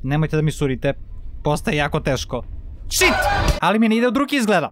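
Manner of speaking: usual speaking voice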